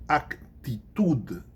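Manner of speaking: Actitude said as Spanish ac-ti-TUD, drawn out; slow Spanish pronunciation. Shown as a demonstration. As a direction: slowly break Actitude into ac... ti-TUD, hard final d. Teacher models Spanish example word slowly.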